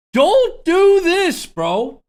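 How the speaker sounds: high, loud, slow outraged plea; exasperated yell. Real, not acted. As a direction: yell at the thief in disbelief. Scolding a re-uploader.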